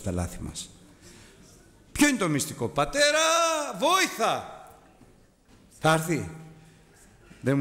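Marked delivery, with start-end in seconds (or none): 2.7-4.5 high, calling plea to father; little boy calling his father for help, high; child's cry for help. Retold or imitated.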